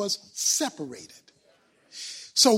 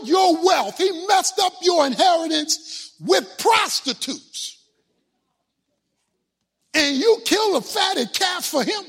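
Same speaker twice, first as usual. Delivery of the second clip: higher, louder aggrieved shouting; angry older son; 5 semitones higher, 5 dB louder, slower than usual. Acted. Older son shouting at his father over the party, angry. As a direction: rail at your father, wounded and furious. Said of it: "Verse thirty".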